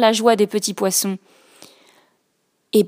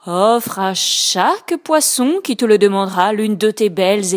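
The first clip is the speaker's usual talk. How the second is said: grave octopus voice; 3 dB louder than usual. Acted. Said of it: "dit Octopus d'une voix grave".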